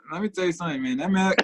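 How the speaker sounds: usual speaking voice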